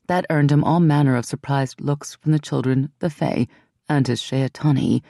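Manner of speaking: ordinary delivery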